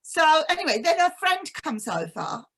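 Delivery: normal talking voice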